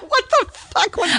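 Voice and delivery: high laughing question. Real, not acted. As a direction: laugh through the words. Laughing at odd sound.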